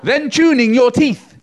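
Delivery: loud, high, slow alliteration; tongue twister demo. Shown as a demonstration. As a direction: punch each T sound. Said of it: "I want you to repeat after me".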